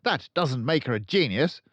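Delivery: loud, high barking headmistress; booming tyrant headmistress; barking Trunchbull. A narrator's reading. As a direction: bark it out like a bully. Trunchbull rants.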